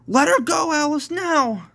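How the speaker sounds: high, loud urgent scream; screamed demand. A narrator's reading. Dylan demands Amber's release, screaming. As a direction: scream the order at a captor. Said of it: "screamed Dylan".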